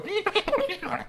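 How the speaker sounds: very high squeaky backwards babble; imitated rewind noise. Shown as a demonstration. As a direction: make a tape-reversing squeal with the voice. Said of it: "sounds where you reverse, you go".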